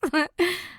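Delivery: short high laugh. Acted. Nicola mocking Fabienne's plan, high laugh. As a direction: laugh at the silly idea. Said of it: "lachte Nicola".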